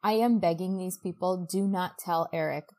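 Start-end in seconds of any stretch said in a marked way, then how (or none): none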